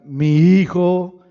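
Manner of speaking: booming slow voice from heaven; slow and huge, calling from the sky; voice of God. Pretend. Preacher booming God's voice from heaven.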